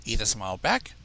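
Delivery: ordinary delivery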